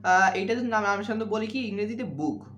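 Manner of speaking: usual speaking voice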